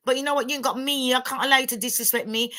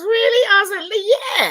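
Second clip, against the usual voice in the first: laughing shout; 10 semitones higher, 7 dB louder, much slower than usual. Real, not acted.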